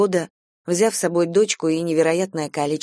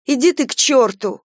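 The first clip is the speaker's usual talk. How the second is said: higher, sudden outburst with restrained volume; angry cry; 7 semitones higher, 5 dB louder than usual. A narrator's reading. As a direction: cry out in anger, not at full force. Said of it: "крикнула она". (сердитый выкрик вполсилы)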